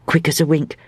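plain reading voice

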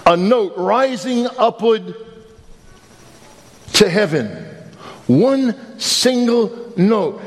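slow, lifted preaching voice; a preacher savouring a revelation; slow sermon build. Acted. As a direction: draw each phrase out with awe. Preacher's slow dramatic reflection.

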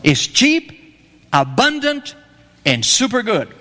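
normal talking voice

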